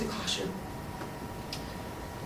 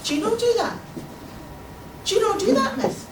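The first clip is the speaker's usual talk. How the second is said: high-pitched puzzled pupil; perplexed; 8 semitones higher, 3 dB quieter than usual. A narrator's reading. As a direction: ask in innocent bafflement, pitched up. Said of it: "looked at me perplexed and said".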